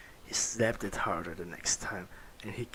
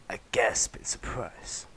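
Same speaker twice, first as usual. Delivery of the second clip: usual speaking voice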